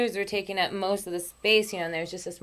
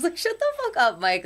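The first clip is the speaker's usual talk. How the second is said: squeaky mock retort; 11 semitones higher, 3 dB louder than usual. Retold teasing retort to Mike.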